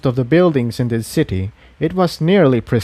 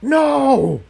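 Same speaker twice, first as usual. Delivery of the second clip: shout; 13 semitones higher than usual. Acted. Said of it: "the voice shouted".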